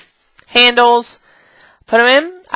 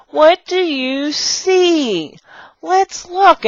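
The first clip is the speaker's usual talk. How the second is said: high, very slow, singsong questioning; teacher's kid voice; 8 semitones higher, much slower than usual. Shown as a demonstration. Teacher prompting kids in class, high slow playful voice. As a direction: stretch it out for small children. Demonstrating talk to young students.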